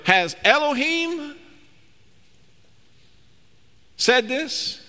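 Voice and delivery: high, slow insinuating question; a sly serpent's lilting question. Acted. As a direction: tempt her like the serpent. Preacher voices serpent questioning Eve.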